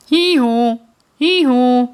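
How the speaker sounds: loud high hee-haw bray; loud donkey hee-haw. Acted. Donkey at classroom window.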